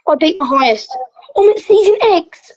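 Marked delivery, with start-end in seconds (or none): 1.4-2.5 sudden high burst of joy; proud yelp of success; excited. Real, not acted. Reaching a new height.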